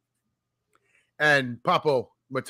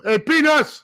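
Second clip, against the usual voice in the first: higher, louder vendor's call; stadium peanut vendor; 12 semitones higher, 6 dB louder, slower than usual. Retold or imitated. Imitating the Yankee Stadium peanut vendors, calling out. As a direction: hawk peanuts up in the stands. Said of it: "the peanut vendors at Yankee Stadium".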